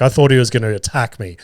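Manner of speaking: normal talking voice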